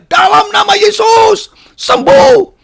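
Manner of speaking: high, loud, drawn-out prayer command; commanding healing shout. Retold or imitated. Man re-enacts praying over dead grandmother, commanding shout. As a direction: proclaim it slowly at full voice.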